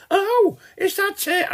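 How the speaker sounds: very high, drawn-out mock surprise; mimicked scrounging visitor. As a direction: squeal it slowly as the cheeky visitor. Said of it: "And say".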